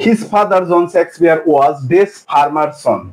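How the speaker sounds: each word read out slowly for the class; slow reading aloud. Shown as a demonstration. Teacher reading a board question slowly to students.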